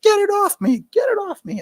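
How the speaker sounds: high panicked mock scream, repeated; high frantic cry of a Marine in the sewer; imitating a panicked scream. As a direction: squeal it like a man covered in filth. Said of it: "you could hear somebody screaming".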